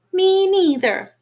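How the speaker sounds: high, slow, smug little kangaroo; little joey voice. A narrator's reading. The baby kangaroo copying her mother, high and smug. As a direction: drawl it like a cheeky echo. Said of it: "the young kangaroo in her pouch said".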